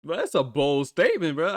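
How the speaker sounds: slow, drawn-out mock outrage; imitating his own stunned anger, high and slow; retold angry disbelief. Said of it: "I got really pissed off here now".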